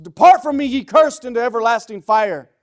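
loud, high shout easing down at the end; booming divine condemnation, falling away; thundering judgment. Acted. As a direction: pronounce a furious sentence, then come down. God pronounces sentence, acted.

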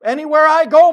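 loud high preaching cry; preaching shout. Real, not acted. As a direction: belt it out to the pews. Preaching climax.

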